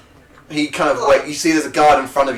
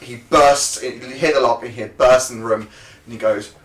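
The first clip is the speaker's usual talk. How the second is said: normal talking voice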